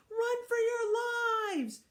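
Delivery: high, slow panicked scream; shouted. Acted. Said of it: "shouted Neil".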